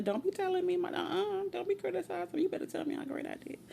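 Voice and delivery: high-pitched sassy protest; mock indignation. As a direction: shut down the critic in a playful high voice. Acting out rejecting criticism from others.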